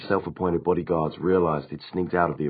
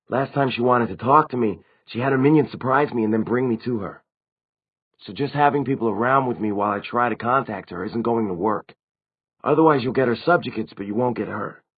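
higher, lightly acted vampire voice; higher-pitched character explaining what happened; vampire recounting events; 5 semitones higher, 4 dB louder than usual. A narrator's reading.

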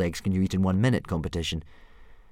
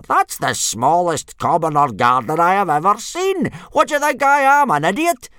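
loud outrage climbing very high; blustering; 12 semitones higher, 10 dB louder than usual. A narrator's reading. Instructor scorning the boy's dragon, blustering loudly. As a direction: rise to an indignant shriek. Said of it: "blustered Gobber".

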